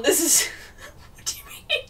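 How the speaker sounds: whispered words broken by high laughter; wheezing laugh. Real, not acted. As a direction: gasp it through laughter. Disbelief at the plot.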